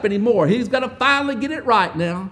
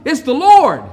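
second "Lord" louder and higher; excited recognition; 7 semitones higher, 9 dB louder than usual. Acted. John telling Peter it's the Lord, rising excitement. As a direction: call it out as you realize who it is. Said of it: "he said".

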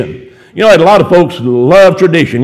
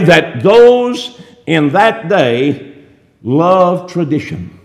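very slow, with heavy pauses between phrases; slow emphasis; much slower than usual. Real, not acted. Slows down to drive point home.

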